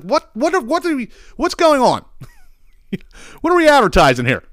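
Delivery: high, baffled outburst. Real, not acted. Host baffled by the mustache commercial, high and incredulous. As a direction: react in total confusion. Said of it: "It's like".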